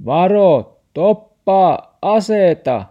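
loud, high urgent yell; shouted warning. A narrator's reading. Grandfather yells at Max to stop, loud shouting. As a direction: holler each command at full volume. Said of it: "Murfaari huusi".

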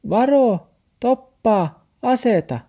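high, loud warning yell; shout. Acted. The dog shouts a warning at Max, very high and loud. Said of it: "ja huusi".

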